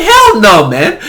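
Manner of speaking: high, loud dismissive hell no; mock-outraged refusal; loud scoff. Real, not acted. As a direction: brush it off loudly. Rejecting average gameplay.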